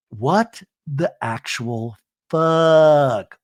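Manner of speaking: very slow, drawn-out disbelief; real disbelief. Real, not acted. Host reacting to Lisa's flight fuss, slow and appalled. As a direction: land each word slowly, incredulous.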